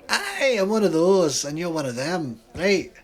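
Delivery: loud, high Scottish retort; sly Scottish accent; thick Scottish accent. Acted. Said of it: "He has a thick Scottish accent".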